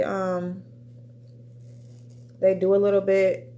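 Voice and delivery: ordinary talk, nothing marked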